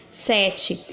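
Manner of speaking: ordinary delivery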